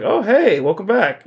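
lightly acted happy welcome; cheery greeting. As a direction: breezily hail a returning friend. Luffy greets returning friend.

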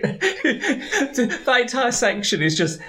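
laughing while talking; giggling through the words. Real, not acted. Laughing about funny scene.

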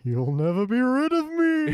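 high eerie ghost taunt; haunt them from beyond; spooky ghost voice. Acted.

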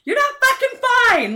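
retold teen shouting match, full-throated; furious yell back. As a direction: yell back that she's not fine. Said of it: "I'm like".

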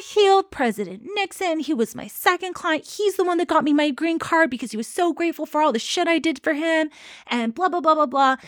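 mock guru ranting to the court; mock ranting Bikram. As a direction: rattle off the brags like an indignant guru. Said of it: "he starts going on this rant".

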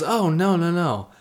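high, innocent sweet denial; sweet old lady voice. Retold or imitated. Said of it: "sweet old lady".

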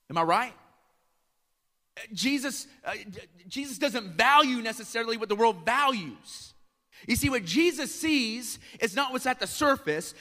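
high, loud, driving sermon voice; full-force preaching. Acted. Preacher urging the congregation, full force.

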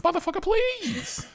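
high, scoffing disbelief; high incredulous retort. Retold or imitated. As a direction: brush the cop off with mock outrage. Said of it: "I'm like".

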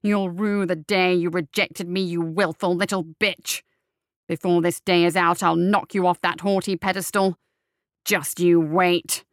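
loud, seething threat; furious threat. Acted. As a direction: spit the threat in rage. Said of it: "boiling with anger".

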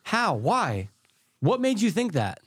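high-pitched retold disbelief; acted-out incredulity, quickening at the end; incredulous questioning. Retells questioning the cult's spaceship belief.

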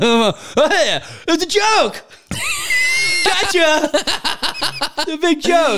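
high, loud fake cheer; comic strained calling out; hammed-up cover-up shouting. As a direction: shout it off to onlookers as a joke. Pretending to onlookers he is okay.

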